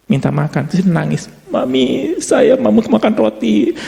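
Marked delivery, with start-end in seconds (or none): 1.5-3.7 put-on high, whiny child begging for bread; crying child. Preacher voicing a crying child asking mommy for bread, high and whiny. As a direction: whimper like a hungry little kid.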